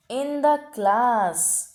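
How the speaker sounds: "in the class" drawn out very slowly with weight; stressed phrase. Shown as a demonstration. Teacher stressing "in the class" very slowly for students. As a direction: lean on "in the class" to mark the group. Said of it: "उसे हम सबसे उच्चतम श्रेणी में रख रहे हैं".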